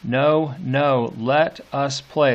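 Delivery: each "No" pressed hard and slow "let us play"; demanding children, slowed and weighted; emphatic. Shown as a demonstration. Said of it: "It's emphatic".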